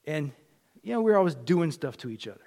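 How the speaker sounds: ordinary delivery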